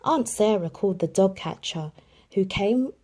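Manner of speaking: ordinary delivery, nothing marked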